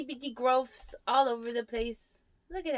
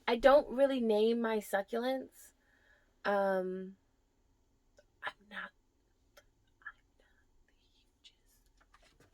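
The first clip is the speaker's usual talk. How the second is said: usual speaking voice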